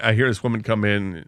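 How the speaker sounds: normal talking voice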